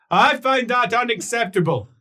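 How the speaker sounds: high, loud outburst; loud objection. Acted. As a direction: call the objection out forcefully. Same line, then called out loudly.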